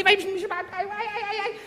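hammed-up terror; mock panic. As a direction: whimper as if about to be crushed. Acting fear of being crushed.

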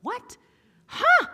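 sudden high squeaky what then huh, loud on huh; baffled. Retold or imitated. Preacher acting out being baffled by God. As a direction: blurt out total confusion. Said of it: "I was like".

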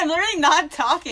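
laughing. Retold or imitated. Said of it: "I was like".

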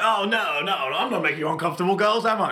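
high, loud sing-song mock sleaze; smarmy creep. Pub worker mimics a creepy customer to young staff, smarmy high voice. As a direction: wheedle like a creep denying it all.

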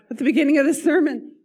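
high, fast laughing aside; laughing disbelief. Real, not acted. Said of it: "losing my voice".

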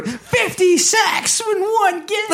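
high laughing exclaim. Real, not acted. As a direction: crack up while saying it. Laughing at absurd stat.

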